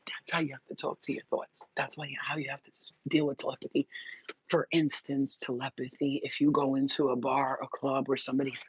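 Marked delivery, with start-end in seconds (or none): 0.1-3.9 whispered aside. Real, not acted. Whispered explanation.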